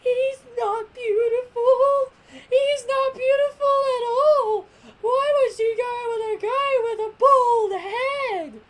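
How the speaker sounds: very high, loud, slow mock wail; Ribrianne. Ribrianne insulting eighteen's husband, hammed-up high wail. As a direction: wail it in a put-on, appalled high voice. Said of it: "and then Ribrianne goes".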